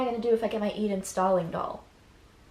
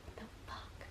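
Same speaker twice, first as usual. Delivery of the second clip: whispered shock; 22 dB quieter than usual. Retold or imitated. Barbie's shocked reaction, whispered.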